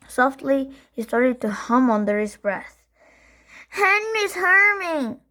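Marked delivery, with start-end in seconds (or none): 3.7-5.2 high, slow crying whine; little brother tattling on humming Henry; whining tattle. A narrator's reading. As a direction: drag out the complaint.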